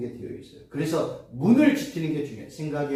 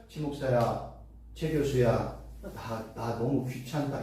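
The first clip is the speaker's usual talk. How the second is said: low, soft, fed-up sigh of a voice; a tired lord brushing off the pleas; weary God voice; 6 semitones lower, 7 dB quieter, slower than usual. Retold or imitated. Preacher voicing a God who is sick of prayers, low and soft. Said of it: "이렇게 얘기 안 하세요".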